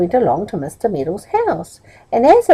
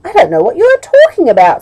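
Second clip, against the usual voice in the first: fast, high, loud cross retort; a higher, louder cross reply; 9 semitones higher, 7 dB louder, faster than usual. Acted. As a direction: snap back impatiently. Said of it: "said Middle crossly".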